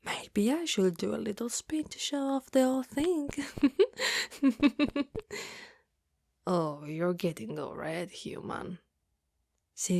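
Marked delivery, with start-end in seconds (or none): 3.3-5.5 high, rippling giggle; long playful titter; teasing giggle. Acted. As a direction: giggle flirtatiously while showing off. Teasing while showing off dress.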